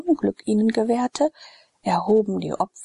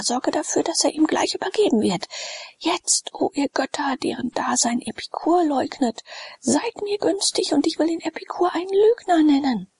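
much higher, desperate plea to the gods; fervent urgent prayer; 9 semitones higher than usual. Acted. Sallust imploring the gods he doubts, fervent and desperate. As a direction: beg the gods with everything at stake.

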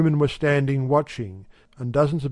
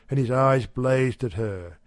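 usual speaking voice

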